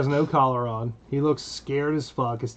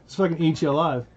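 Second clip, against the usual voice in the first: normal talking voice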